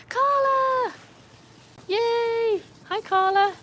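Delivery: very high, loud cheering greeting; excited call. Real, not acted. Streamer spots a regular arriving in chat, excited high call. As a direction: sing out a delighted, high-pitched hello. Greeting a viewer who joins.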